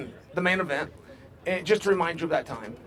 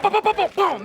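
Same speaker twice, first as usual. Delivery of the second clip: high, punchy rapid-fire booms; imitated punch flurry; 13 semitones higher, 6 dB louder than usual. Shown as a demonstration.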